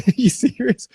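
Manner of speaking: squeaky high disbelief, half laughing; incredulous. Retold or imitated. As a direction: act stunned at their fuss, voice jumping high. Mocking safety officers' fuss.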